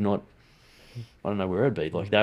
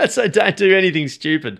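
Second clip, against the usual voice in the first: laughing; 10 semitones higher, 9 dB louder than usual. Real, not acted. Laughs after mum advice.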